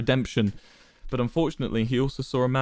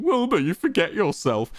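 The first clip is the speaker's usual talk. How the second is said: higher mock of Quackity's line; 8 semitones higher than usual. A narrator's reading. Wilbur mocking Quackity's intonation, higher sneering mimicry. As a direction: parrot his words back with scorn. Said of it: "mocking Quackity's intonation".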